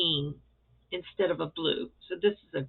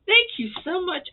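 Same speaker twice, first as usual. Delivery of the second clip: gushing thanks; 10 semitones higher, 5 dB louder than usual. Real, not acted.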